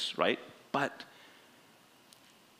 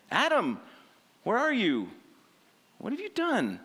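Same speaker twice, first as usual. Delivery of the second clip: God calling; 12 semitones higher than usual. Acted.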